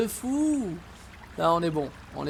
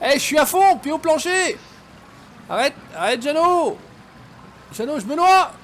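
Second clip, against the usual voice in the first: frantic, higher and louder; too much caffeine; 6 semitones higher, 5 dB louder than usual. Real, not acted. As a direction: hyper panicked rush. Panicking car swept away.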